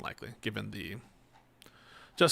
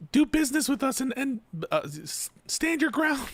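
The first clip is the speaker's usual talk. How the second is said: mocking high voice; 14 semitones higher than usual. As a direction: sneer it in a high voice. Mocking corporate stance, high voice.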